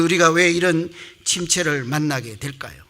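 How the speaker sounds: normal talking voice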